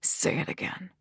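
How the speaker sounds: very soft whispered order; hushed command. A narrator's reading. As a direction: whisper it like a demand. Male character repeats his snarled command.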